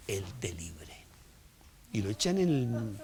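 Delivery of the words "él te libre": hushed whispered blessing; a king's whispered farewell to Daniel. Acted. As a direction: breathe the blessing out softly. King blesses Daniel at the pit.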